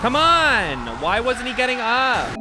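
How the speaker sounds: high, loud, outraged yelling; furious shout. Real, not acted. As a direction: shout at the stuck character on screen. Raging at her game character.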